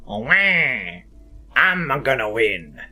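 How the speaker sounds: low, slow cartoon Wario boast; Wario impression. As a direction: gloat like a greedy cartoon villain. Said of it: "That's Wario and Waluigi".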